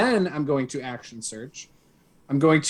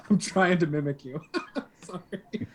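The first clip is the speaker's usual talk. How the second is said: laughing; 4 semitones higher, 6 dB quieter than usual. Real, not acted. Laughing at own impression.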